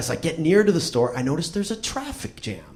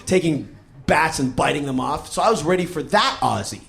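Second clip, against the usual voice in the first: ordinary talk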